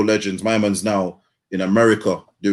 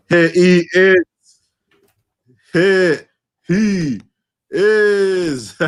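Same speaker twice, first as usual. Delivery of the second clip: loud, high, drawn-out greeting; slow, rising hype-man announcement; hyped welcome; 7 semitones higher, 4 dB louder, much slower than usual. Real, not acted. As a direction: stretch each word out loud and high to hail a friend. Hails co-host joining the show.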